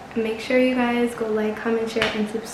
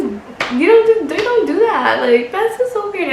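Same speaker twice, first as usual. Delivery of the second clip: pitched way up, louder, scandalized; indignant disbelief; 7 semitones higher, 6 dB louder than usual. Real, not acted. Ranting to viewers about a date who went in her purse, high indignant disbelief. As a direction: climb high with outrage at the nerve of it. Outrage at date's purse grab.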